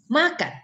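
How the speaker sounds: MAKAN starting high and loud, falling quickly; high falling tune. Shown as a demonstration. Teacher showing there is no fixed rule, high falling makan. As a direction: hit makan high, then drop it. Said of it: "menyebutnya harus".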